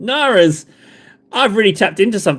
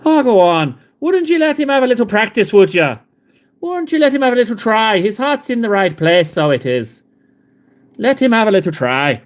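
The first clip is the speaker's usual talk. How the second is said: higher, lilting mock Irish plea; Irish accent; 4 semitones higher than usual. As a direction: coax them gently in a hammed-up Irish lilt. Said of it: "sounds like we've got an Irish off".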